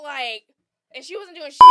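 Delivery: usual speaking voice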